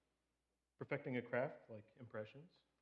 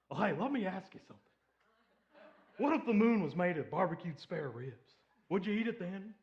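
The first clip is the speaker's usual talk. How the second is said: Will Ferrell's Harry Carey; 5 semitones higher, 7 dB louder than usual. Retold or imitated. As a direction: ham up an old baseball announcer. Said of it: "Harry Carey looks over to him and he goes".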